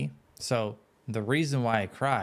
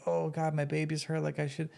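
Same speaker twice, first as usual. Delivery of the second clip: lightly raised, mock-anxious parent gasp; mock worried parent; 6 semitones higher than usual. Dad imitating a worried parent over a hurt baby. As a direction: fret like a panicked parent, half-joking.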